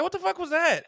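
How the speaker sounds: high, loud startled exclamation; shocked outburst. Retold or imitated. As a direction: blurt it out, caught off guard by your own voice. Shocked at his own code switch.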